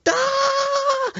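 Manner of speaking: loud high "gotcha" shout; sudden scare yell. Retold or imitated. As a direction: yell it to make someone jump. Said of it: "he scares her".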